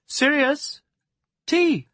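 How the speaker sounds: high, loud call through a doorway. A narrator's reading. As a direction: sing out to someone in another room. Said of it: "she called through the open door".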